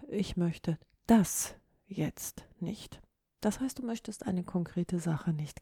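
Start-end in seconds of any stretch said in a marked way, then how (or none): 0.1-3.0 slow, „das“ punched and followed by pauses; single out „das“ with force; stress on „das“. Shown as a demonstration. Podcaster shows refusing one particular thing, stress on „das“. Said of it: "Die dritte Form der Betonung".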